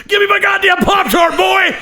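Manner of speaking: high, loud enraged dad yell; top-of-lungs scream. Retold or imitated. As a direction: scream it out of nowhere. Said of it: "screams at the top of his lungs".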